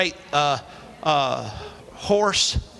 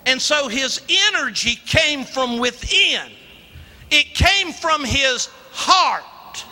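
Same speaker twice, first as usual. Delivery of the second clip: ordinary talk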